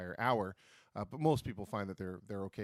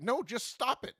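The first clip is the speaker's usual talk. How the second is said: impatient doctor; 6 dB louder, slower than usual. Retold or imitated. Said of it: "he's like".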